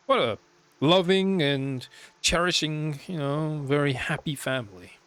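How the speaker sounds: loud, slow sarcastic praise. Real, not acted. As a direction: drip sarcasm on loving family. Mocking the horrible family.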